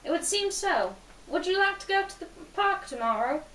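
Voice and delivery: put-on high-pitched British accent; Charlie McDonald. Boy invites girl to the park, in a British accent. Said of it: "said in a smooth British accent".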